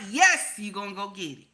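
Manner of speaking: normal talking voice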